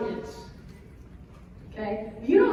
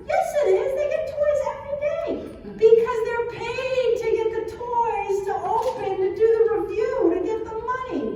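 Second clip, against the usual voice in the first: put-on much higher, louder fast child protest, laughing; arguing kid; 11 semitones higher, 4 dB louder than usual. Son protesting the internet is real, high and loud. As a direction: argue back like an indignant six-year-old.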